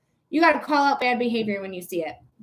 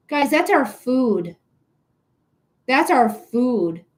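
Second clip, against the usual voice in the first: slow, heavy stress on our; slow emphatic plea; slower than usual. Real, not acted. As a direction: lean on the word our, drawn out. Urging viewers about food shortage.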